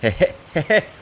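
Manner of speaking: high loud excited laugh; cartoon laugh. Real, not acted. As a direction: burst out laughing with joy. Finds secret island.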